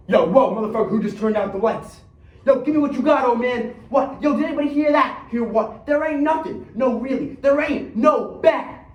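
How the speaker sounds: high, loud, alarmed street-thug chatter; agitated thugs. Acted. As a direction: play jumpy robbers squabbling in the dark. Thugs panicking in the dark.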